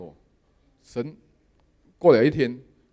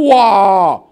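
overjoyed; 8 semitones higher, 13 dB louder, slower than usual. Retold or imitated. Overjoyed at winning the contract.

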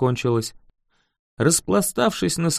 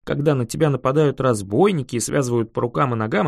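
ordinary delivery, nothing marked

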